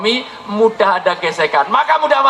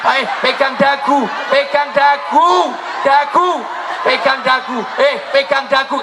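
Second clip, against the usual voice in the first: shouted command; 6 semitones higher than usual. Real, not acted. Preacher drills congregation in movement.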